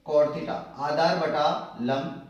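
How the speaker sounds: cot theta आधार बटा लंब drawn out very slowly; slow recitation. Shown as a demonstration. Teacher reads the reversed formula aloud, very slowly. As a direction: dictate it slowly, stressing Aadhaar. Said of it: "इसको यूं पढ़ेंगे उल्टा".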